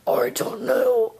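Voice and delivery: low, slow disguised voice; changed voice. Acted. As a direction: drop the pitch and drag it out, covering up. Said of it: "Why did your voice change like that".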